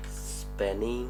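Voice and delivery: ordinary delivery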